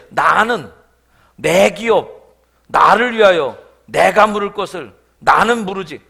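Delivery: loud, slowed "나" stressed each time; selfish emphasis. Shown as a demonstration. Pastor mimicking the kinsman's self-centred speech, every "나" stressed. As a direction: hammer every "나" to expose selfishness. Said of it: "화법을 보면 이런 거예요".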